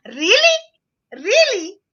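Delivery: thrilled, can't believe the compliment; delighted surprise. Retold or imitated. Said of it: "She said".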